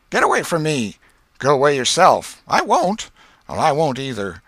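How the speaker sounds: loud, high shoving-match taunts; two boys snapping at each other; boys quarreling. Acted.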